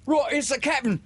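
high, loud startled pirate. Acted. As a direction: sailors thrown off guard. Pirates answer in surprise.

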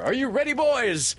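put-on high, joyful boyish call; rally them with a high, joyful cry. A narrator's reading. Peter calls to his boys, joyful and high. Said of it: "came the joyful voice of Peter".

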